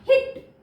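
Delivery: हिट high and short, ending on the t; short closed vowel. Shown as a demonstration. Teacher contrasting closed syllable, हिट with short vowel. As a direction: cut हिट short with a quick "ih". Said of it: "close है तो ई की sound".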